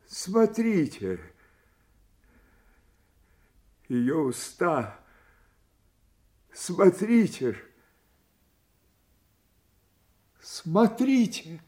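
high-pitched, desperate insistence; urgent pleading. Acted. Dying father urging others to see his daughter's lips, desperately insistent. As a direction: urge them to look, voice soaring on the repeats.

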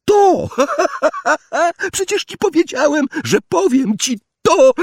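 very high, loud gleeful cackle; shrieking victorious laughter; cackling poltergeist. A narrator's reading. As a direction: crow in triumph with cackles. Peeves cackling triumphantly at Filch.

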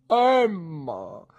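high, loud, falling call; impassioned shout of her name; theatrical cry. A narrator's reading. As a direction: cry out the name with great passion. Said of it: "gridò mister Micawber".

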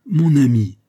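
leaning on the words, loud and high; condescendingly chummy, heavily stressed; disdainful familiarity. A narrator's reading. Aramis talking down to Fouquet, with disdainful familiarity. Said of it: "avec une sorte de familiarité dédaigneuse".

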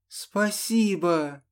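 happy high thanks, drawn out; pleased blurt. A narrator's reading. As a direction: burst out with delight. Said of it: "выпалила довольно девушка". (радостно, довольно)

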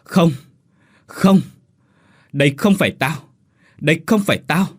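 high, loud cries of denial; acted outcry in short bursts; sudden hoarse scream. A narrator's reading. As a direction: shriek in horror at the mirror. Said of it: "bất chợt hét lên".

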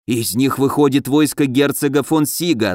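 plain reading voice

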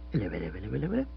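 low, soft, slightly wavering greeting; a shy, nervous hello; nervous quaver. Acted. Bill nervously interrupts the couple at the seat, soft quavering hello. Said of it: "just a quaver of nervousness".

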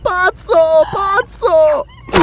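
high-pitched, loud frantic scream. A narrator's reading. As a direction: scream it at him in horror. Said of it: "urlava Belesa".

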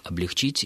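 «облегчить» with stress on final -чить; ударение на последний слог. Shown as a demonstration. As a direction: say «облегчить», stressing the last syllable. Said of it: "ударение на последний слог". («облегчить» с ударением на «и» в конце)

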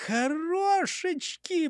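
high, drawn-out cheer; eager chiming in. A narrator's reading. Bird welcomes the pilot idea, high eager cry. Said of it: "подхватил Густав Гейер".

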